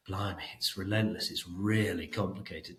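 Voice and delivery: low, subdued grumble of overwhelmed heads; mock complaint. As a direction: imitate heads moaning, softly and low. Heads' weary complaint, acted.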